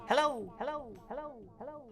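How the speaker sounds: high fading echo hellos; echoey. Pretend. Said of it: "You wanna say it all echoey?".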